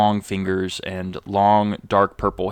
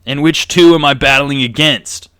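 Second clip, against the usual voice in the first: loud, higher challenge; brash Craig; 3 semitones higher, 9 dB louder than usual. Acted. Craig sizing up the challengers, brash and loud. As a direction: call them out with swagger. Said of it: "Craig looks at you all, he goes".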